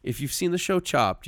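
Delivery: bubbling over about the plan; excited. Real, not acted. Pitching a fun podcast idea.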